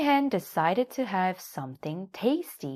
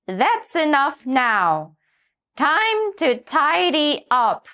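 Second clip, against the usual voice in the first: firm, slow, louder hen; stern; 10 semitones higher, 8 dB louder, slower than usual. A narrator's reading. Hen sternly tells the donkey to tidy up. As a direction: lay down the law. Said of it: "looked at him sternly".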